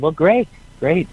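high, slow, stretched-out great; drawn out fake-cheery praise; mock delight. Dad reacting to job news.

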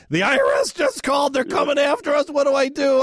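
high-pitched panicked retelling; frantic wife. Retold or imitated. Wife phones husband about the tax office, frantic. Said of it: "My wife called me frantic".

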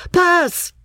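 very high call to a teammate; urgent game shout. A narrator's reading. Boys shouting in a football game.

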